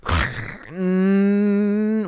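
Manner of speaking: high, sustained droning noise; imitated audio noise. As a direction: mimic a harsh sound turning into a long high hum. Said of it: "it turns and it goes".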